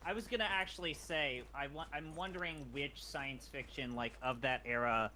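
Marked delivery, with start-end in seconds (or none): none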